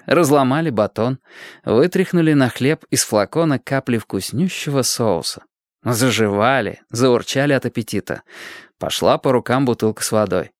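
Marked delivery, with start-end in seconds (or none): none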